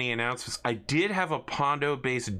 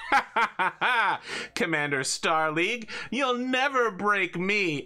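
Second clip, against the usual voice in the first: hammy villain laugh; 9 semitones higher, 3 dB louder than usual. Acted. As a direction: laugh it off like a cartoon baddie. Villain defiant in interrogation chair.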